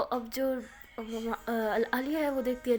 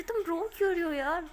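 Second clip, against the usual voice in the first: higher, alarmed question to a crying friend; worried; 6 semitones higher than usual. Acted. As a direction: rush in, worried for her. Friend finds her crying. (گھبرائی ہوئی، اونچی آواز میں)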